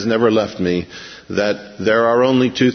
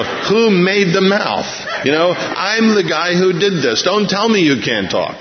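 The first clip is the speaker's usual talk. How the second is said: higher-pitched, incredulous mock rebuke; exasperated; 7 semitones higher than usual. God answering Moses' excuse that he can't talk, exasperated. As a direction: play an exasperated God putting an objector in his place.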